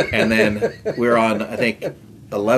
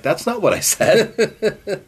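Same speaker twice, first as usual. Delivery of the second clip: high, slow, incredulous mock protest; exasperated retort; 12 semitones higher, slower than usual. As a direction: play up the disbelief. Exasperated retort to cart girl.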